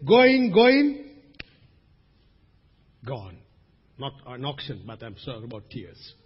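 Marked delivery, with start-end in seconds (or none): 0.1-1.1 high, loud calling with a rising end; auctioneer's call. Acted. Preacher mimics auctioneer closing on tears, calling out. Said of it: "like the auctioneer says". 3.1-3.4 sudden low, soft drop after a long pause; auctioneer's closing word. Acted. Preacher as auctioneer closes the sale on tears, hushed. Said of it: "like the auctioneer says".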